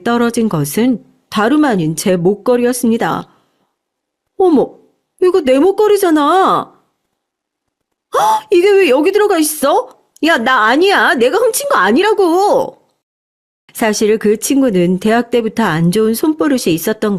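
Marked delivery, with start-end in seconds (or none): 4.4-6.7 high-pitched acted astonishment; startled. A narrator's reading. Owner spotting her necklace on the floor, high and startled. 8.1-12.8 high, startled protest of innocence; panicked denial. A narrator's reading. Caught friend denying she stole it, high-pitched and panicked.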